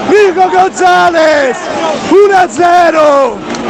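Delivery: screaming goal call, high and loud; breathless italian goal commentary. Real, not acted. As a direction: yell the goal with all you have.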